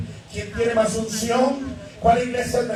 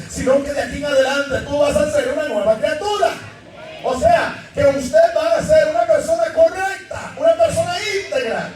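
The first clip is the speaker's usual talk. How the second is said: full-force preaching; 4 semitones higher than usual. Acted. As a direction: exhort the congregation, pushing higher. Preaching new creature.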